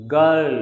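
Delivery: put-on "girl" without any R; silent R. Shown as a demonstration. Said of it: "not girl". ("girl" में R silent)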